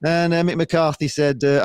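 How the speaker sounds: usual speaking voice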